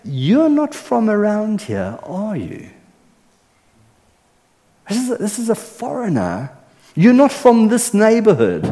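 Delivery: high, slowed, wondering voice of an amazed bystander; astonished onlooker. Pretend. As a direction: marvel at a stranger, lightly voiced. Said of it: "It's like saying".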